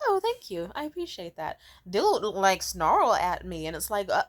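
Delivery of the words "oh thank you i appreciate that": high, sweet mock-polite thanks. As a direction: gush thanks at the door. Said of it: "I'm like".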